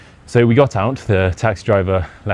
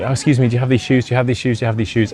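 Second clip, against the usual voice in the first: higher, fast, repeated polite asking; asking shop staff; 4 semitones higher, faster than usual. Retold or imitated. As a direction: rattle off the question shop to shop.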